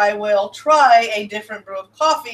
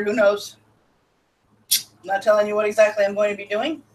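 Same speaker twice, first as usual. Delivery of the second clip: normal talking voice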